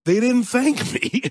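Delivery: high, slow quote breaking into laughter; the tearful executive, played for laughs; laughing through quote. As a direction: laugh while voicing the crying executive. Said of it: "And they were like".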